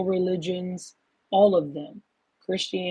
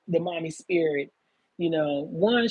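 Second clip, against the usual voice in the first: ordinary talk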